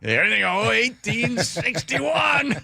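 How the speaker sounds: high, drawn-out mock indignation; mock reenactor. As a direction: stretch it out like a stickler reenactor. Mocking reenactors who insist on period.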